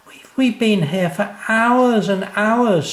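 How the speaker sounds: put-on high, loud, slow child's wonder; amazed little boy. Sam tells Dad the hours flew, high and amazed. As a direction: marvel like a small boy.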